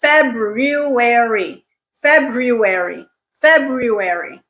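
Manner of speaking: February slowly as FEB-roo-ary; pronounced middle R. Shown as a demonstration. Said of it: "make sure you pronounce it".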